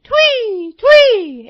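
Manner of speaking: very high, loud chirping twee; bird chirp. Acted. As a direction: chirp it like a robin. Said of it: "in his own voice".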